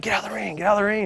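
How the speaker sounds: imitating a promoter hustling someone out; urgent order.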